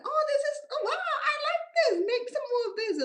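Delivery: very high, loud mock raving; imitated squealing delight. Acting out sweetheart raving over food.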